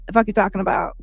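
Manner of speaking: normal talking voice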